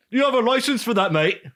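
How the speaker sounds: high, loud mock Aussie cop; hammed-up Australian accent; Australian police challenge, mock Aussie. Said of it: "They're not Australian".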